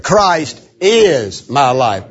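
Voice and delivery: "is" drawn out and punched; slow, with heavy weight on "is"; stress on is. Shown as a demonstration. Preacher emphasizing the second word, stress on "is". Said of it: "The second word".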